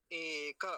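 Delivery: एक as e-ka, final a fully sounded; correct Sanskrit pronunciation. Shown as a demonstration. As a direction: stretch एक into two syllables, e-ka. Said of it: "अब इसको भी गलत बोलते हैं".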